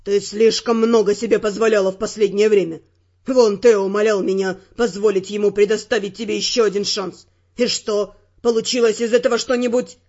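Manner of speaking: low male voice, loud scolding; angry Ferrant. Acted. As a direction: lecture her hotly.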